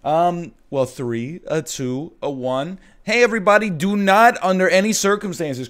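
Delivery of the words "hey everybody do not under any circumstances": high, loud host opener; loud show intro. Acted. Hosts loudly open the show.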